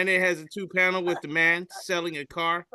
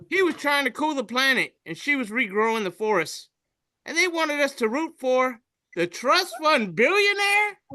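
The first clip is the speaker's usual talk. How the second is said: indignant read; 9 semitones higher than usual. Retold or imitated. Reading an indignant meme post.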